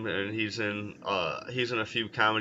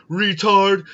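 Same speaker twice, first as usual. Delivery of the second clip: loud, high mock yell; exclaimed at raised pitch and volume; imitated shout; 9 semitones higher, 9 dB louder than usual. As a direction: yell it out like the film character. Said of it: "he's all like".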